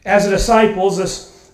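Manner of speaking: ordinary delivery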